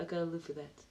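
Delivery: soft echo of a film monologue; understated movie-line mimicry; Morgan Freeman. Echoing Morgan Freeman's film lines.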